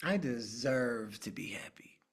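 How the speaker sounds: breathy silent whisper, no voice at all. Shown as a demonstration. Mouth it in a hushed whisper. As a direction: coach whispering the affirmation to the group, unvoiced whisper. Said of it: "without making a sound".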